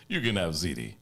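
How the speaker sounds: pumped-up TV pitchman, loud and bright; infomercial. Pretend. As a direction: sell it like a late-night TV ad. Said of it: "let's do an infomercial for Spaghetti World".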